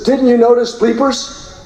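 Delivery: a corpsman snapping back at the reporters; defiant corpsman retort. Retold or imitated. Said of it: "gestures over to him and says".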